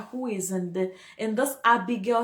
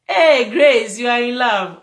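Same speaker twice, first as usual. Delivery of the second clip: loud, excited hail with a laugh in it; calling out; 3 semitones higher, 14 dB louder than usual. Real, not acted. Host hailing Grace on hearing she is in love, calling out loudly. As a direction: call out big and teasing, much louder. Calls out to a commenter.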